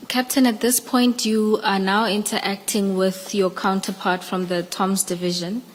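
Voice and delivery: ordinary talk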